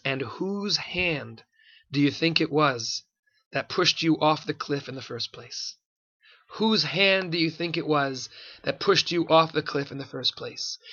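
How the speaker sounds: quiet pointed question. Retold or imitated. Rabbi's pointed reply to biker.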